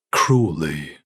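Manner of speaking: ordinary delivery, nothing marked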